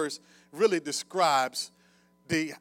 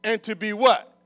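raised, loud sermon delivery with punched key words; forceful preaching; 6 semitones higher, 12 dB louder than usual. Real, not acted. Preacher quoting Paul to the congregation, forceful. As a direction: preach it out to the whole room.

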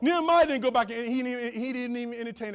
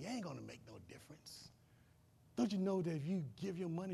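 whispering doubt; 3 semitones lower, 10 dB quieter than usual. Acted. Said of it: "whispering in your ear".

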